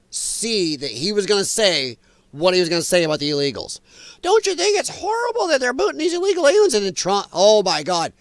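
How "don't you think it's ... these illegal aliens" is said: high, rushed mock-outraged question; mock talk-show host. Talk-show host goading a guest against Trump, mock outrage. Mimicking The View hosts' leading question.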